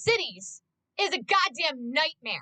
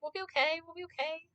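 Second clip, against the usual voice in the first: calm reassurance; 8 dB quieter than usual. Retold or imitated. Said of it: "It's like".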